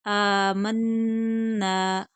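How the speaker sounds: Amanna very slow, long आ and humming doubled न; stretched with ghunna. Shown as a demonstration. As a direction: hold the न of Amanna as a nasal hum. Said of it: "Hamza को खींच कर पढ़ेंगे". (आमन्ना, गुन्ना के साथ खींच कर)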